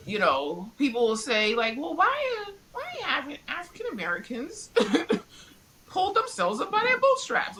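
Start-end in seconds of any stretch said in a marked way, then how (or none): 1.8-7.6 mock naive question. Mocking what people say.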